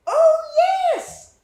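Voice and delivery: put-on high, sweet child's answer; sweet as apples, a small boy's voice; sweet little boy voice. Little boy on the subway answering the narrator, high and sweet. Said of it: "in a voice sweet the way apples are sweet".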